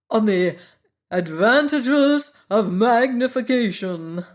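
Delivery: proud Wogglebug showing off his coat; pompous Wogglebug. A narrator's reading. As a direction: speak like a self-important bug. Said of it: "Wogglebug announcing his lectures".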